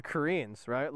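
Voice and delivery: ordinary delivery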